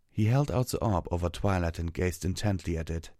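plain reading voice

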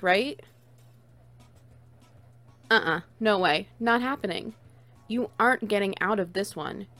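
normal talking voice

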